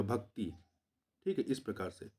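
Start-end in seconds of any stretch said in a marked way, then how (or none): 0.0-0.5 भक्ति soft and low, short i; short final vowel. Shown as a demonstration. Teacher showing the short vowel sign, भक्ति with short i. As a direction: say भक्ति as "bhak-ti", dropping away. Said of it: "छोटी की मात्रा रहेगा".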